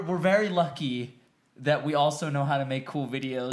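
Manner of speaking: normal talking voice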